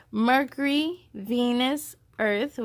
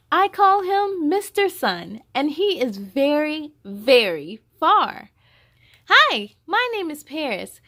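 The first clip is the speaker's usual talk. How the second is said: bright, louder storybook voice with higher lines; reading to children; 5 semitones higher, 7 dB louder than usual. Acted. Host reading the picture book aloud to young viewers. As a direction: read it out big and lively for little ones.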